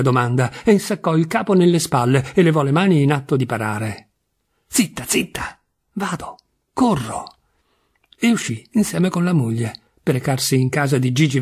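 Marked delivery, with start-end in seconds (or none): 4.7-7.2 higher, whispery, hurried-to-soothe words; hushed placating. A narrator's reading. Husband bracing for his wife's outburst, hushed placating. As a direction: hush them as if warding off anger.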